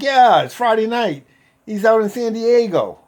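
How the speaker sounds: high, loud retold reply; calling back. Others answering the patient looking around for Roscoe, calling back.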